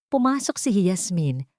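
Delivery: usual speaking voice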